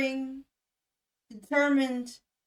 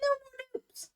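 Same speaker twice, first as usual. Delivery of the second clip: put-on much higher, softer little-kid voice; childish plea; 12 semitones higher, 5 dB quieter than usual. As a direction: play the small child begging to stop. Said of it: "The other person would say".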